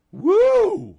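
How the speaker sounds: loud, high whoop; whooping exhale of amazement; stunned "woo". Acted. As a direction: let out a big high "woo!" after the outburst. Host reacts to guest's outburst.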